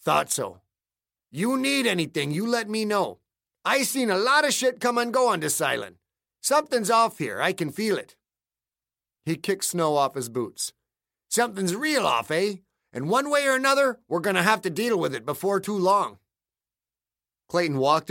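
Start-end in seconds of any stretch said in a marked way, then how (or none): 0.0-8.1 a hint of a weathered local man, raised pitch; lightly voiced grizzled islander; gruff old islander. A narrator's reading. Narrator voices Clayton. 11.3-16.2 a hint of a weathered local man, raised pitch; lightly voiced grizzled islander; gruff old islander. A narrator's reading. Narrator voices Clayton.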